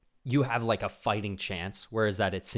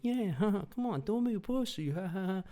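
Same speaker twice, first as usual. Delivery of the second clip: high mocking laughing jeer; distant taunting jock; 12 semitones higher than usual. Jock jeering heard from a distance, high mocking laugh. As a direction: laugh it at him from far off. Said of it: "you hear, like".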